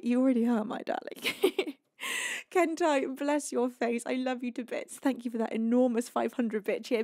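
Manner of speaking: ordinary delivery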